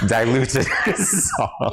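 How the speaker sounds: drawn out with a laugh in it; amused, laughing translation. Real, not acted. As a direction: say it through laughter, slowly. Translating slang for viewers, laughing.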